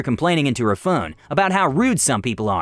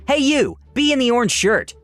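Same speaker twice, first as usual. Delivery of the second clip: mock yell; 9 semitones higher than usual. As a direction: call out rudely across the aisle. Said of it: "then she yelled".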